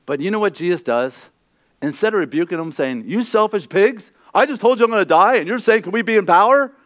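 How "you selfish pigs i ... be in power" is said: higher, louder indignant rebuke; mock rebuke. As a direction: scold them in mock outrage. Hypothetical rebuking Jesus.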